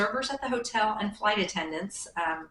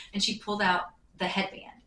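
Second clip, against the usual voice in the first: ordinary delivery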